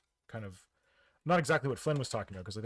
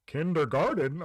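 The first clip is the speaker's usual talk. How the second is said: imitated English-accented "Kindergarten", raised pitch; 4 semitones higher, 7 dB louder than usual. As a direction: say "Kindergarten" the English way, both r sounds voiced. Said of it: "Just say it with an English accent".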